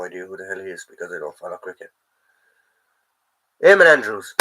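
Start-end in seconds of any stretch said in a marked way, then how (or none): 3.6-4.3 remembered it. Real, not acted. Suddenly remembers the forgotten name.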